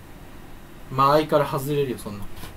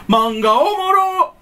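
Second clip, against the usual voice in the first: much higher, very slow, loud "おもろ"; exaggerated fake Kansai; 12 semitones higher, 5 dB louder, much slower than usual. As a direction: stretch "おもろ" way up like a parody. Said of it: "エセ関西弁". (高くゆっくり誇張したエセ関西弁)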